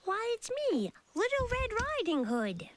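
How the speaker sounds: put-on high bright child voice; sweet little girl at the door; girl announcing herself to grandma.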